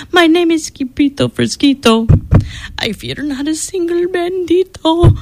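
ordinary delivery, nothing marked

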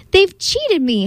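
a touch of an angry howl. A narrator's reading. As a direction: wail it like a cheated man, lightly. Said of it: "howled Tim".

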